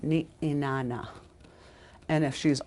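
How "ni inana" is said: ni inana with a clean stop between ni and inana; glottal stop. Shown as a demonstration. Teacher marking the stop in ni inana for the class. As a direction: break the vowels in ni inana with a catch. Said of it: "there's a stop right here".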